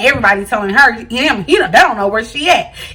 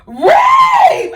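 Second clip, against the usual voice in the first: put-on [name] voice. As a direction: squeak it out like [name], half laughing. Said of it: "In my [name] voice".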